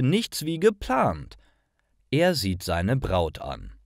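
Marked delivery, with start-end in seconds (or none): none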